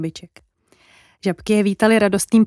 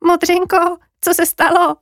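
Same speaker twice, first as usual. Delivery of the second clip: high-pitched, concerned little sheep; sweet high question to a friend; concerned calling out; 9 semitones higher, 4 dB louder than usual. Acted. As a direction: call out with worry, pitched high. Sheep calls to distressed jay.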